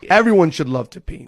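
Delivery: normal talking voice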